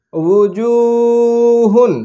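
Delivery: very slow, long-held blend; drawn-out joined word. Shown as a demonstration. Teacher showing pupils how syllables join, very slow. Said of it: "যুক্ত করে".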